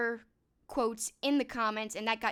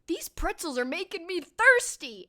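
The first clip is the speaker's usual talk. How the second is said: mock pretzel line; 7 semitones higher, 7 dB louder than usual.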